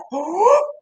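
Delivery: high quick gasp in; sharp inhale. Acted. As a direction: suck in a breath. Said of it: "Pete holds his breath".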